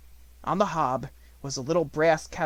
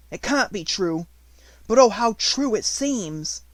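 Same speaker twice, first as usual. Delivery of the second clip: high, loud, slowing exclamation; overwhelmed with joy; excited wonder; 7 semitones higher, 6 dB louder than usual. Acted.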